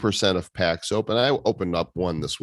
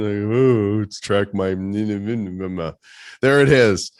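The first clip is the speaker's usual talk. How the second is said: mock sarcastic, slightly louder; 2 semitones higher, 4 dB louder, much slower than usual. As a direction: drawl it out sarcastically. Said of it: "He's just sarcastic".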